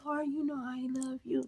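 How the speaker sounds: mock the prim holy way of talking; hushed, syrupy devotion; sugary pious voice. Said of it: "talking like this".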